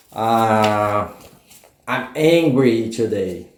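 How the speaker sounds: low, slow mock-angry grumble; acted angry. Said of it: "escolher outro emoji e dizer". (zangado de brincadeira, lento e grave)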